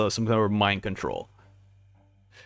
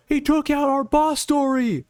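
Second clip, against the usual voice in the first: high, alarmed voice of a panicking giant; scared underling voice, pitched far up; frightened giant; 14 semitones higher than usual. Acted. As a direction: squeak out the shock at the demon.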